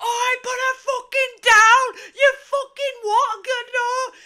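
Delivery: high, roared Yorkshire yell; shrieked Yorkshire outrage; broad Yorkshire accent. Retold or imitated. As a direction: bellow it in broad Yorkshire, drawing out the words. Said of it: "with a broad, broad Yorkshire accent going".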